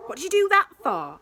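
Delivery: high, loud startled cry; startled outburst. Acted. Max startled by the button, high and loud. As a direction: jump and blurt it out.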